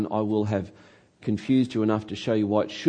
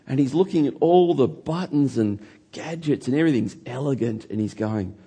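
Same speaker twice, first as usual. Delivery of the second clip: normal talking voice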